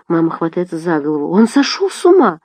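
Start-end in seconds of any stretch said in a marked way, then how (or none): 1.3-2.4 high, dismayed exclamation; aghast outcry. A narrator's reading. Mother hearing about the library, aghast. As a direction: exclaim in disbelief, pitched high. Mother appalled, clutches her head.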